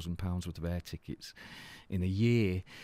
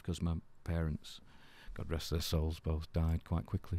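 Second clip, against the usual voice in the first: subdued grief; 2 semitones lower, 8 dB quieter than usual. Real, not acted. Recalling a loved friend in hospital, quietly sad. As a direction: recall it quietly, with restrained sadness.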